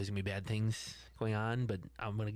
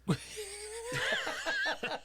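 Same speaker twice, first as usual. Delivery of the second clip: high shouted laugh. Real, not acted. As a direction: let out a whooping laugh. Big laugh.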